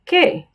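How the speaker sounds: high ¿Qué? with a hard k; ¿Qué? said as keh, hard k; Spanish qu. Shown as a demonstration. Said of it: "Has a k sound".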